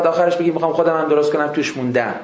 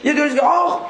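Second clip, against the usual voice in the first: mock cry of pain; 8 semitones higher, faster than usual. Customer cries out in pain.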